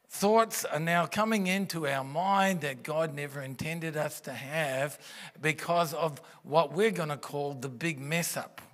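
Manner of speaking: ordinary talk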